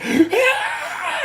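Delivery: very high squealing shriek, half laughing; imitated shriek. Imitating his partner seeing a stink bug, laughing squeal. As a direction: shriek like a bug is on your arm. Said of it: "And she's like".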